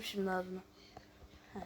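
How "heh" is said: ordinary talk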